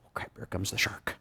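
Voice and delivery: hushed, scared whisper; whispered dread. Retold or imitated. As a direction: breathe it like the shark is near. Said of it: "How many of you went".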